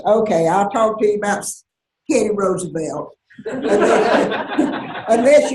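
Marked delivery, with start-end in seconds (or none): 3.5-5.6 laughing tease, fast and louder. Real, not acted. Teasing the interviewer about another topic, laughing. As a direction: tease them through a giggle.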